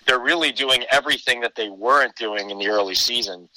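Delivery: ordinary talk, nothing marked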